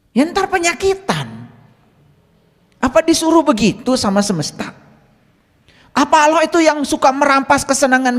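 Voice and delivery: retold fatherly nagging, building in pitch; retold grumbling. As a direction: grumble under restraint, then rise into an earnest telling-off. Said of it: "ngomel aja".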